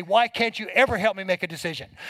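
normal talking voice

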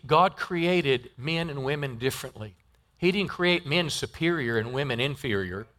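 ordinary delivery

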